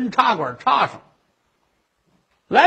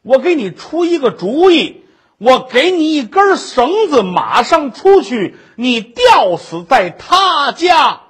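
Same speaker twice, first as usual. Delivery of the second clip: put-on drunken fury; 4 dB louder than usual. Drunk husband ordering his wife to hang herself, loud and furious. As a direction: hammer out each order, ending in a yell.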